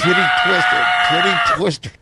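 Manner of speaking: shrieking. Acted. Shrieking during titty twister.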